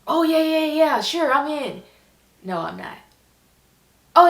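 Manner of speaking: ordinary talk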